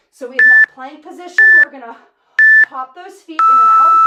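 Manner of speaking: shouted instruction; loud call. Real, not acted. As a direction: call it over the music. Coach calls the next move.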